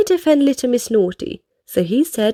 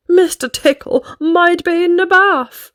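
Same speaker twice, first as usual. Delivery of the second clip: worried Mister Worry; 6 semitones higher than usual. A narrator's reading. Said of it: "said Mister Worry".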